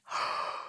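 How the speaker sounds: put-on big breathy yawn to show the child; demonstrated yawn. Shown as a demonstration. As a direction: open up into an audible yawn. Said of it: "can you yawn".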